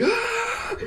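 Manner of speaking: very high, soft strained scream; mock yell. Fan imitating the fighters' yelling faces in close-up, mock yell. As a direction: imitate a battle scream without the volume. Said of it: "close-ups of their faces yelling".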